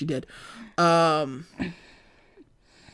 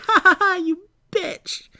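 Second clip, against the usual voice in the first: mock fake laugh; 11 semitones higher than usual. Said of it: "behind her voice".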